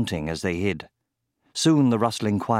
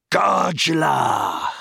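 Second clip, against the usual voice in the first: high, drawn-out battle cry; shouted spell; 7 semitones higher, 4 dB louder than usual. A narrator's reading. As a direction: shout it like a spell being hurled. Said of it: "and shouted".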